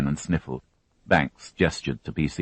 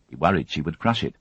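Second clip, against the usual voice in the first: usual speaking voice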